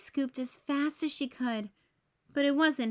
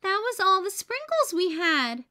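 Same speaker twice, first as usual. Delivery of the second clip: higher, louder story-voice complaint; complaining; 8 semitones higher, 5 dB louder than usual. A narrator's reading. Emily moans that the sprinkles are all gone, complaining. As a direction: complain about it, pitched up. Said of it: "complained Emily".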